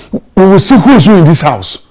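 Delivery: loud, high-pitched furious vow; angry threat. Acted. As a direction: shout it as a household showdown. Father threatens after quarrel.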